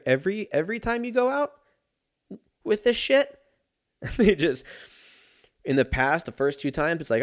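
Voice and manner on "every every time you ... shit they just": laughing. Real, not acted. Laughing ranting about poop.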